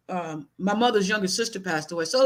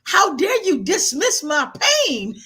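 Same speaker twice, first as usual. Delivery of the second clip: high, louder, indignant rush; 9 semitones higher, 5 dB louder than usual. Real, not acted. Son telling how grandmother dismissed his grief, indignant. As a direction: relive the anger at being dismissed. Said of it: "I was kind of pissed off".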